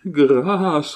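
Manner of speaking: high, tearful wail; wailing for mercy. A narrator's reading. Servant begging his master for mercy, wailing high. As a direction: cry out for mercy through tears. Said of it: "répéta Longue Avoine qui frissonna".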